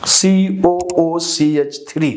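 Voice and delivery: spelled out slowly, piece by piece; slow, measured spelling-out of a formula; slow dictation. Real, not acted. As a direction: dictate each symbol separately.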